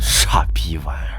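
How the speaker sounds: hissed curse under the breath; contemptuous whisper to himself; whispered insult. Acted.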